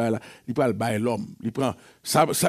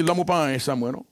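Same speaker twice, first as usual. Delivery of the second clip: usual speaking voice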